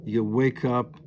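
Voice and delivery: usual speaking voice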